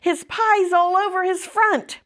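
high-pitched, mildly shouted complaint; storyteller's light version of a child shouting; shouted tattling. A narrator's reading. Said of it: "shouted Fern".

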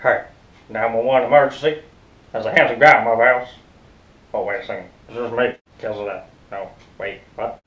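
normal talking voice

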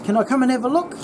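high, mock-surprised uncle. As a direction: perk up like the uncle hearing news. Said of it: "And he goes".

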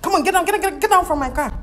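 loud, high indignant yelling; mock furious driver ordering her out; driver's angry outburst. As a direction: throw her out of the car, furious.

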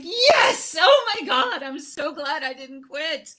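high-pitched burst of glad relief that softens toward the end; joyful relief. Retold or imitated. Waking to find she didn't quit, overjoyed and relieved. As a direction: shout the yes, then let the delight settle. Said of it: "I woke up and I was like".

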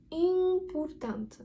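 IMPORTANT with the first vowel drawn out through the nose; nasal Portuguese M. Shown as a demonstration. Teacher shows learners the nasal M, drawn-out nasal IMPORTANT. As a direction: hold a long nasal vowel in IMPORTANT, lips open. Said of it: "стає назальною".

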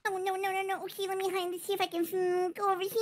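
soft muttering while hiding; hushed self-talk. Pretend. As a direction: talk under your breath as you hunt for a hiding spot. Hider mutters while finding a spot.

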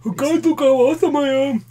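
high-pitched self-admiring put-on voice; mock show-off. Podcaster mocking a limelight hog, high show-off voice. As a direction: gush in a high mock-vain voice.

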